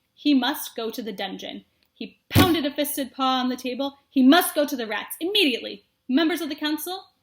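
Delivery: loud, pounding command from the head mouse; head mouse roaring his verdict; stern booming decree. Acted. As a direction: thunder the sentence like a judge. Head mouse pounding table, sentencing.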